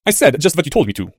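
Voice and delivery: ordinary delivery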